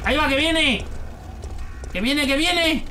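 high, urgent cry; alarmed warning. Real, not acted. Player seeing an enemy coming, high alarmed warning. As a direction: call it out high as danger closes in.

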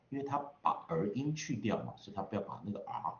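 usual speaking voice

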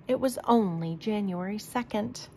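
plain reading voice

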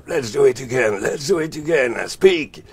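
voix aiguë et forte qui ordonne, imitée; voix au téléphone imitée. Retold or imitated. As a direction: imitate a high, loud, urgent voice on the line. Cite la voix entendue au téléphone. (voix perchée et forte, imitée)

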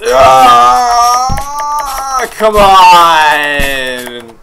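long, loud drawn-out groaning shout; will it over the gap, yelling; straining yell. Real, not acted. Player trying to make it, straining drawn-out yell.

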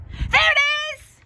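put-on high, thrilled child's cry; high-pitched shout of discovery; excited shout. A narrator's reading. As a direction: call out in sudden delight. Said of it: "he shouted excitedly".

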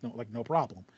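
normal talking voice

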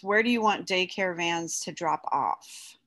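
usual speaking voice

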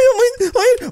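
high, fake crying plea; mock sobbing. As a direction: cry out in mock despair. Prisoner begs, mock crying. (yüksek perdeden sahte ağlama)

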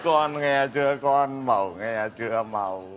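deep voice. Acted. Father asks his daughter if she heard, in a deep voice. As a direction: sink the voice way down like a crooner. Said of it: "Cái giọng trầm quá".

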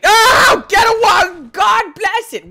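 high, loud frantic cries; panicked yelling. Real, not acted. Gamer yelling in a panic while chased, high and loud. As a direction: panic and yell.

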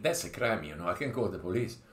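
ordinary delivery

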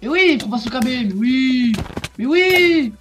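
loud sarcastic yelling; raging gamer. Real, not acted. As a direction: bark it out, fed up with the opponent. Losing a PvP fight.

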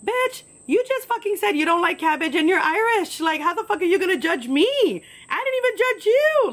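high-pitched, worked-up comeback; indignant outburst. Acted. Waitress's unspoken retort to judging customer, indignant outburst.

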